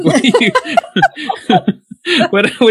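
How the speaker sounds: breaking into high laughter; cracking up mid-question; laughing. Real, not acted.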